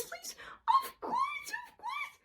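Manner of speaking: very high, very soft squeaky voice; tiny high puppet voice. Acted. Voicing J P and self.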